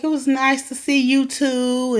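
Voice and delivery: usual speaking voice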